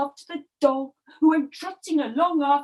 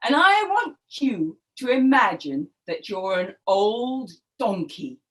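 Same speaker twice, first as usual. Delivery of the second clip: usual speaking voice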